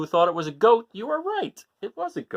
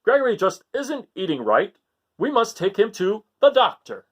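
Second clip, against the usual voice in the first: loud exasperated father; Father Goat fed up; 7 semitones higher, 6 dB louder than usual. Acted. Father fed up with his son's eating, loud and exasperated. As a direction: put your foot down loudly. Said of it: "he said".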